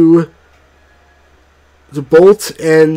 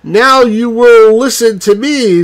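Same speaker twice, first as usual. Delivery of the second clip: commanding, louder and higher; authoritative; 6 semitones higher, 4 dB louder than usual. A narrator's reading. The mother silences the king and queen, authoritative and louder. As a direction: take charge of the crowd. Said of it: "in an authoritative tone".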